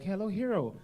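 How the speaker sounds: a hint of a woman's phone greeting; caller's female voice. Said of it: "She was like".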